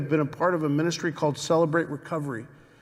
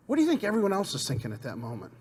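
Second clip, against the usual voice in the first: ordinary delivery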